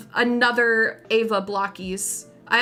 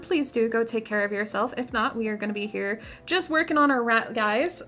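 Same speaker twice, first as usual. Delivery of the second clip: ordinary talk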